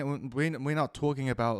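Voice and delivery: ordinary talk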